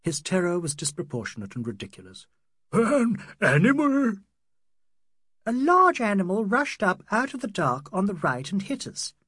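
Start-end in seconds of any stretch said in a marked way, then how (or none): 2.7-4.2 put-on high, loud, drawn-out old man's cry; terrified cry. A narrator's reading. Old man hearing they hit an animal, crying out in terror. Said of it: "A loud cry broke from the old man. His terror". 5.5-9.1 high-voiced, excited young woman; insistent Adela. Adela telling what rushed out of the dark, excitedly.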